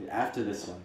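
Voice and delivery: normal talking voice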